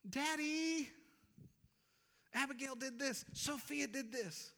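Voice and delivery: put-on high-pitched child complaining to Daddy; tattling child. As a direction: whine it like a little kid telling on a sister. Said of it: "I try to remind my children".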